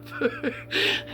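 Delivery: airy, high tired giggle; breathless giggle. Real, not acted. Worn-out vlogger to viewers, airy giggle. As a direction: let a worn-out laugh escape. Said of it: "And I'm glad I'm laughing".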